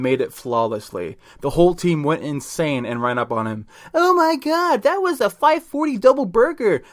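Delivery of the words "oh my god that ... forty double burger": very high-pitched excited little player; shortest player. Acted. Shortest player reacts to the dunk, amazed squeal. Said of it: "the shortest player said".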